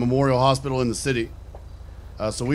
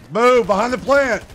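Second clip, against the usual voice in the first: startled shout; 10 semitones higher, 5 dB louder than usual. Acted. Startled shout during ambush.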